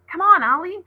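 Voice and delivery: loud, high call from the tree. A narrator's reading. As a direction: shout it across to her friend. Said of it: "she called".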